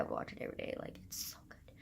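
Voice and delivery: breathless gushing whisper. Real, not acted. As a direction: whisper it like a secret love. Gushing over Aquamarine.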